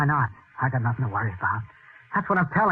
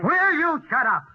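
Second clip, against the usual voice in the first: loud, higher, slow furious yell; angry yell; 13 semitones higher, 5 dB louder, slower than usual. Acted. Husband yells at his wife to shut up, furious. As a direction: finally lose it and yell at her.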